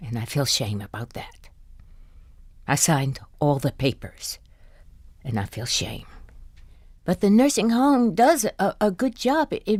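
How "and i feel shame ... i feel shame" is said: soft, low confession; quiet shame. Acted. Wife confessing her shame, soft and low. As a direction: admit it almost to yourself.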